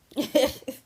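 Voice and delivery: short, high, loud laugh; real brief laugh. Real, not acted. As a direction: burst out in a quick high chuckle. Laughs after saying "Well.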